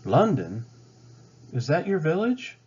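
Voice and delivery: curious question. Acted. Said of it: "Pocahontas asked".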